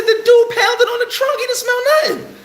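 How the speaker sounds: protesting to the cops that the dog smelled nothing; incredulous protest. Retold or imitated. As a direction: squeal it out, unable to believe it. Arguing with cops, livid.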